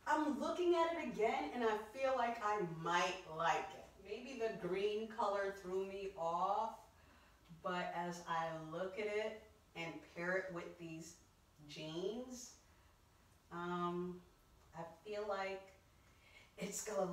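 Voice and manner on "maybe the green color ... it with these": normal talking voice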